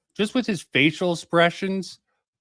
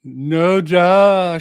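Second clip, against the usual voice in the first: annoyed loud outburst; 4 semitones higher, 8 dB louder than usual. Real, not acted.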